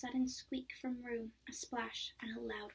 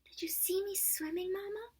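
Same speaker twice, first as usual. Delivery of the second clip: much higher, excited little squeak; 10 semitones higher, 5 dB louder than usual. A narrator's reading. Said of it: "squeaked Roo excitedly".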